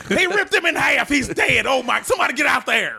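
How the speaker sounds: high shouted ringside commentary; Jim Ross. Pretend. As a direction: yell like a disaster in the ring. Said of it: "I always do Jim Ross".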